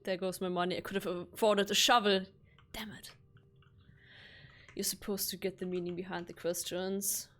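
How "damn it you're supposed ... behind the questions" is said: unvoiced, soft whisper. Real, not acted. Streamer muttering about the puzzle, in a whisper. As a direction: murmur it as a private aside. Aside while playing.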